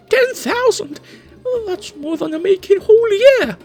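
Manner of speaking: high, loud, fast disbelief; shocked protest. Acted. Khaled aghast at the orc's demand, high, loud and fast. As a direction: squawk in outrage at the sum.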